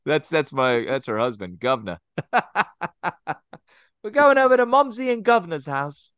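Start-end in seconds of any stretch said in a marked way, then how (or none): 4.0-6.1 much higher mock British accent. Joking about mom's house, hammed-up British accent. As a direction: play the silly Brit visiting for pudding. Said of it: "like, British".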